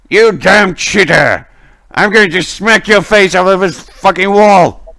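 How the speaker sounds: loud, high furious yelling; angry scream. Acted. Guard raging at a cheater in the next room, angry scream. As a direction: scream threats at a card cheat. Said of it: "somebody screams like".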